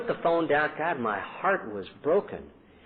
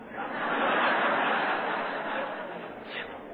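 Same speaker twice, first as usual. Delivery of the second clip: soft breathy knowing laugh; rueful laugh; 4 dB quieter than usual. Real, not acted. Father laughing when his son asks about drinking. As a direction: laugh at the irony. Wry answer to son's question.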